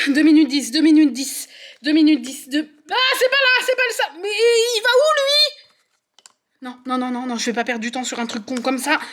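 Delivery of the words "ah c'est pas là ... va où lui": frantic high-pitched wail; near-crying panic, pitch way up; high panicked cry. Real, not acted. Panicked searching for puzzle piece.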